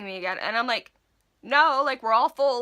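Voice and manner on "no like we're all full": loud, raised-pitch mock refusal; acted-out loud, hurried excuse to a customer; insistent lie. Clerk fibbing to get him out of the store, loud and insistent.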